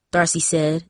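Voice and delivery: ordinary delivery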